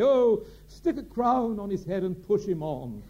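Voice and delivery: high, loud, slow mocking drawl; mocking friends. Friends taking him down a peg, high, slow and mocking. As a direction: tease him like a bored friend deflating an ego. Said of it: "they would always say".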